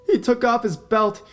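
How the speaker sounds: put-on girl in terror calling the narrator; panicked girl. A narrator's reading. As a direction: panic as the belt comes off. Girl in danger on the phone.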